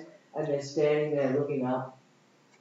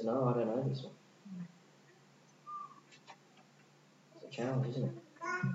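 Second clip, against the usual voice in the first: hushed sober sermon; 3 semitones lower, 7 dB quieter than usual. Acted. Hushed warning passage.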